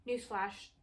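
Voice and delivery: normal talking voice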